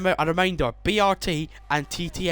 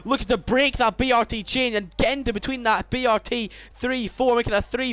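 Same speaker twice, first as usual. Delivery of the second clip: excited commentary; 9 semitones higher, 3 dB louder than usual. Real, not acted. Excited race call.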